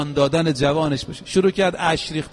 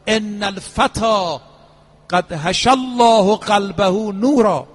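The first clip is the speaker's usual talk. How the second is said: slow, ringing Arabic quotation, swelling louder; solemn proclamation; 2 semitones higher, 4 dB louder, slower than usual. Retold or imitated. Preacher quoting the imam over a fallen youth, loud and drawn out. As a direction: proclaim it slowly, building in volume.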